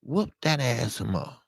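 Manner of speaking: tossing the dare off quietly. Retold or imitated. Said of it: "I'm gonna say".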